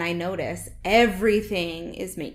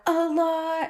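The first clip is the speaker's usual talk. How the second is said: high mock answer; 7 semitones higher than usual. Friend voicing her own answer, pitched up. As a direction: squeak it out high, a put-on little voice. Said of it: "It's like".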